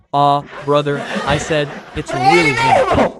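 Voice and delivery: exaggerated impression, squealed slowly; Anderson Silva. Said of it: "The guy doesn't even talk like that".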